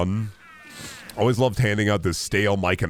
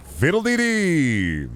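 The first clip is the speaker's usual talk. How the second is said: long, high, drawn-out cry; catchphrase bit; 10 semitones higher, much slower than usual. Acted. Streamer going into a catchphrase bit, high drawn-out catchphrase. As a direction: sing it out high and stretched, then fall. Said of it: "And I be going".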